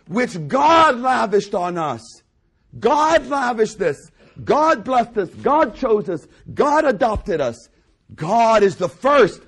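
loud, drawn-out preacher's proclamation; ringing pulpit declarations; forceful preaching. Real, not acted. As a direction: hammer each short line like a preacher.

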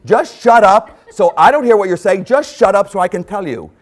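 hammed-up spouse telling the other to shut up; mock spouse snapping. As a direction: mimic a spouse cutting in. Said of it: "how we communicate with our husbands and wives".

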